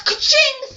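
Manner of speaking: loud, high-pitched ka-ching sound effect; vocal slash effect; imitated weapon strike. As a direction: punch out a weapon's impact noise.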